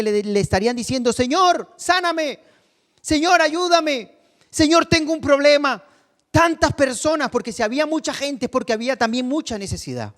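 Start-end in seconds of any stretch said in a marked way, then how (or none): 1.1-5.8 high, urgent calls for help; shouted pleas. Acted. Crowd begging Jesus for healing, shouting. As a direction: cry out high, like one of a needy crowd. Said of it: "gente que estaría gritando".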